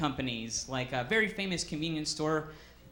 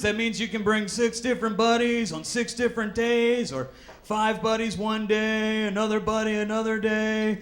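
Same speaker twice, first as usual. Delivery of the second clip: pushy salesman; 2 semitones higher, 6 dB louder than usual. Retold or imitated. Gym salesman pushing buddy passes on a customer, hammed-up.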